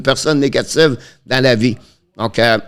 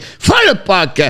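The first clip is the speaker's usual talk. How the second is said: sudden high-pitched cry on the shot; excited shot call; 8 semitones higher than usual. Real, not acted. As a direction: burst out high as the shot is fired. Calls a shot in play-by-play.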